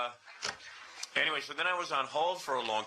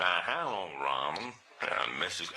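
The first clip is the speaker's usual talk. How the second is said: lower, slow caller voice; the Dwayne voice; 7 semitones lower, slower than usual. Pretend. Fake caller Dwayne introducing himself, low and slow. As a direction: drop low and take it slow. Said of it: "So I got the voice".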